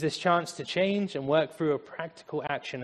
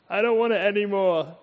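put-on childish whine; 4 semitones higher, 3 dB louder than usual. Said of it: "I start bursting out crying".

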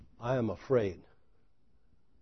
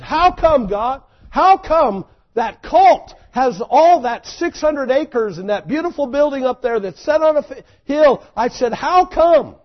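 much higher, loud anguished cry to God; 10 semitones higher, 8 dB louder than usual. Retold or imitated. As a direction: shout the question up at heaven. Crying out to God at night.

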